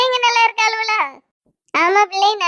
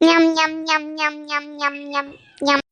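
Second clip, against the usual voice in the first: low, fading chomp chomp sounds; greedy sulky munching, quieter and quieter; munching noises; 5 semitones lower, 4 dB quieter, slower than usual. Acted. Sulking sister munching snacks after threatening to leave. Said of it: "மொங்கு மொங்குன்னு மொங்கிட்டு".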